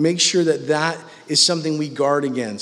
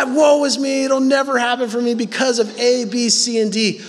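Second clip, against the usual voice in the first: mopey voice; 7 semitones higher than usual. Retold or imitated. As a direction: ham up the woe-is-me moan. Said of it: "doing that voice".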